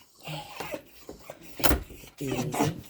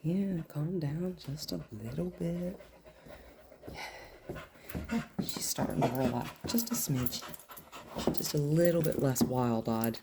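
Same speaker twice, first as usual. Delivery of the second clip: soothing; 5 dB quieter than usual. Real, not acted. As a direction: coax her into calm, softly. Soothing the dog.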